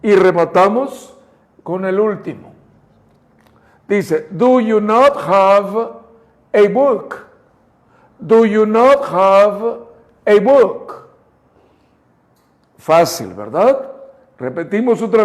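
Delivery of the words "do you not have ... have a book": slow, clear, "not" stressed; slow clean demo. Shown as a demonstration. Teacher giving a slow, clean model for students. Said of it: "Y sale limpia la voz".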